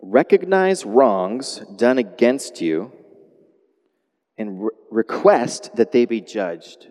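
slow, deliberate note-taking read; slow dictation. Shown as a demonstration. Pastor giving the congregation point three, slow dictation. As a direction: spell it out slowly for their notes. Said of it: "Point number three".

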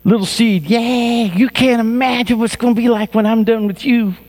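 high, eager voice; excited gardener. Acted. Preacher voicing the grower to the seed, high and eager. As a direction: gush over the little seed.

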